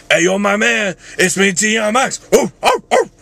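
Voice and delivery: high rapper shout with barked oohs. Acted. Vlogger retrying a favorite voice, high rapper shout. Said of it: "favorite voices to do is D M X".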